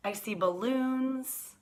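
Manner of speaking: ordinary delivery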